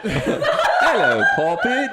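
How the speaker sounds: put-on loud, high, silly British accent; hammed-up British accent. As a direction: overdo a British greeting for a laugh. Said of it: "in a British accent".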